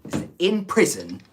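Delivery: put-on high-pitched, botched Tate accent; Andrew Tate impression. Streamer fumbling the prison speech, high Tate accent attempt. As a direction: push Tate's accent up high and miss it. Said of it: "that's not right".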